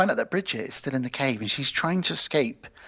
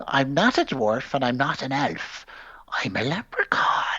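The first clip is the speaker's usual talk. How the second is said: indignant leprechaun. Retold or imitated. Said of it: "He says to him".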